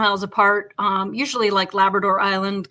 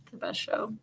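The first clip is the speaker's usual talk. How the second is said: breathless whisper, much softer; whispered aside; 12 dB quieter than usual. Real, not acted. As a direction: drop to a whisper for the verdict. Whispered aside about show.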